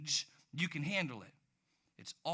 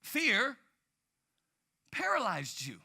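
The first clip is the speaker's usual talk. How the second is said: ordinary delivery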